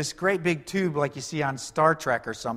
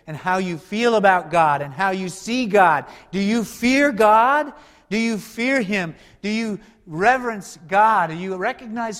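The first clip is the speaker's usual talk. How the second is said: raised, louder, higher preaching; preaching at full force; 5 semitones higher, 8 dB louder than usual. Real, not acted. Pastor pressing his congregation, loud and high. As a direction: press the question hard on the crowd.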